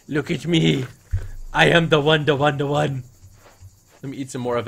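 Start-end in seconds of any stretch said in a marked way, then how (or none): 0.1-3.0 loud bragging. Pretend. Bragging after the kill.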